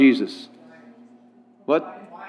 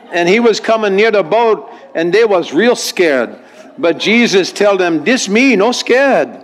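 storytelling in Hawaiian Pidgin, quote pitched up; 4 semitones higher than usual. Acted. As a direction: Hawaiian Pidgin accent, lifting the quoted words high. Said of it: "translation from our English into Hawaiian Pidgin".

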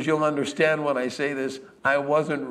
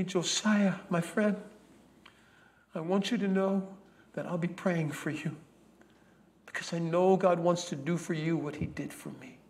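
tearful plea; 3 semitones higher, 5 dB quieter than usual. Acted. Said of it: "Tears were streaming down her face".

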